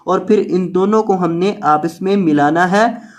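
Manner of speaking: normal talking voice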